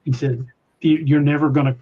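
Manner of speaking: normal talking voice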